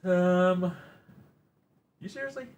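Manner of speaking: high, incredulous squeaky voice; high mock disbelief at being called relaxing; mock squeak. Incredulous reply to chat.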